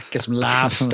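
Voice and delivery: put-on Boston accent. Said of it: "any of our Boston listeners hate us".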